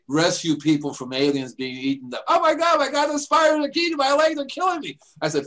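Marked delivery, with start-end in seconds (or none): 2.3-5.0 high, frantic cry for help; panicked high voice. Retold or imitated. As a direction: squeal it like a scared kid. Panicked gamer screaming in game.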